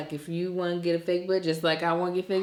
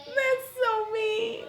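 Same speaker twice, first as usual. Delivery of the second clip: high, slow, wailing laugh-cry; mock laughing sob; much slower than usual. Real, not acted. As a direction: pretend to weep while laughing. Mock crying at a harsh joke.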